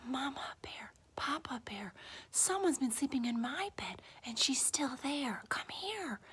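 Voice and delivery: hushed, high little-bear voice; urgent whisper. A narrator's reading. Baby Bear finding she's still there, urgent whisper. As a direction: whisper it urgently so she won't wake.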